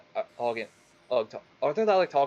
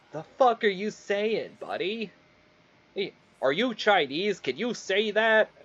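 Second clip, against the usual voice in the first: higher, incredulous questioning; mock indignant challenge; 8 semitones higher than usual. Imagined friend doubting the teller's heritage, mock indignant. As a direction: challenge him in a put-on, high voice.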